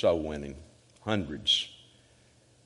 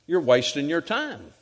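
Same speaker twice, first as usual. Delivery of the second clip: high, loud exhorting preacher; full-force preaching; 8 semitones higher, 4 dB louder than usual. Real, not acted. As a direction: preach it hard, punching the key words.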